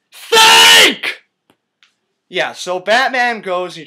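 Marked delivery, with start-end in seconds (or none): 0.1-1.2 sudden high, booming yell; exasperated shout. Real, not acted. Reviewer yelling at Batman over the obvious solution, exasperated. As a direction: scream the single word in exasperation.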